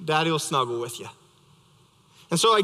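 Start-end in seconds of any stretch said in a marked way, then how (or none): 0.0-1.1 tender reply. Retold or imitated. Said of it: "I said".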